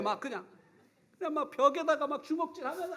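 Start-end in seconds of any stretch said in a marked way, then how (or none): none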